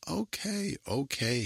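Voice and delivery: high-pitched cut-in; high, then dropping, to stop the talker; interrupting. A narrator's reading. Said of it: "Hannah Meyer interrupted".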